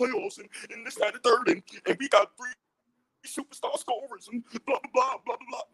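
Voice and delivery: lightly voiced mock trash talk; mocking Nets fan. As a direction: mimic a smug fan berating you, blah blah dismissive. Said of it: "just berating us".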